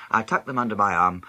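ordinary delivery, nothing marked

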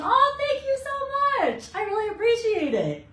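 high-pitched grateful gush; delighted thanks. Real, not acted. Stylist thanking a viewer for praise, delighted gush.

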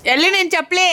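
high, loud household shout; calling out. Real, not acted. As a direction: call across the house. Calling to someone about slippers.

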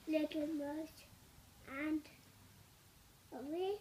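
usual speaking voice

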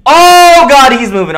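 high, loud panicked cry; panicked scream. Real, not acted. Streamer seeing the animatronic move, panicked scream. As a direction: scream as he realises it moved.

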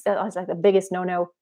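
ordinary talk, nothing marked

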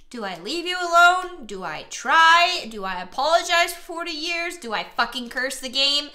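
building to a high, loud exasperated yell; frustrated rant. Real, not acted. Gamer yelling his frustration at the game's choices. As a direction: vent at the game, rising.

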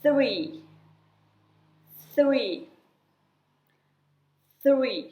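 "three" starting with tongue out, air blown through the teeth; airy TH. Shown as a demonstration. Teacher drilling "three" for children, airy TH. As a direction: model "three" with a clear breathy th. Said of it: "แลบลิ้นแล้วให้ลมมันออกมาตามไรฟัน".

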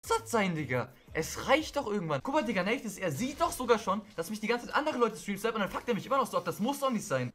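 high-pitched, indignant rant; exasperated complaint. Real, not acted. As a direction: protest in wounded frustration. Victim complains about being sniped.

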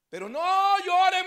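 loud, high, slow shout of joy; joyful crying shout. Retold or imitated. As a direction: cry out through tears of joy. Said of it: "Y cuando él está llorando".

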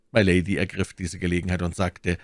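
usual speaking voice

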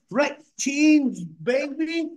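high, drawn-out hype call; excited high-pitched holler; hyped shout-out. Real, not acted. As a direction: stretch the name out like a hype man. Hyped shout-out to chat name.

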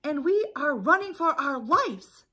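high, loud alarm cry; hammed-up panic. Chicken Little thinks the sky is falling, panicked cry. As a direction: raise the alarm like a frantic cartoon chick.

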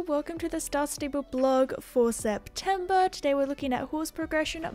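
ordinary talk

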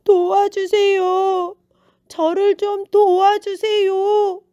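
high, loud, drawn-out plea; beggar's long, loud cry for help; pleading beggar. A narrator's reading. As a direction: call out slowly for help like a beggar. Blind beggar calls out for help.

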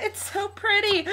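high, tearful squeal; happy crying. Real, not acted. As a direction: choke up with joy. Overwhelmed by birthday gift.

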